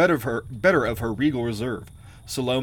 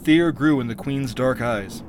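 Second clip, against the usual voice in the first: plain reading voice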